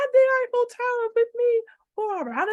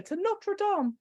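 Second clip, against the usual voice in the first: normal talking voice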